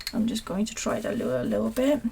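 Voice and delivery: whispered under breath; whispering. Real, not acted. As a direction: whisper it to yourself. Muttering while painting.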